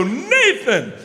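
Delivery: high, long call of NATHAN; yelled name. Retold or imitated. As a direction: holler Nathan across a backyard. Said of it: "the second I yell".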